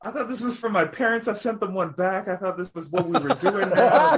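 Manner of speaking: high, laughing disbelief; laughing surprise at the mixed-up gift; embarrassed surprise. Real, not acted. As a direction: realise the gift came from them and squirm. Embarrassed realisation about gift.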